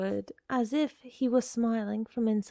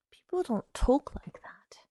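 breath-heavy, higher-pitched muttering; muttered; 5 semitones higher than usual. Acted. Girl backing from the door, muttering to herself. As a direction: mutter it in shaken disbelief. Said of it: "she muttered".

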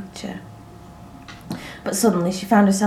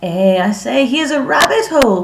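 higher and louder, sudden delight; excited cry; 5 semitones higher, 5 dB louder than usual. A narrator's reading. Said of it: "cried Anne suddenly".

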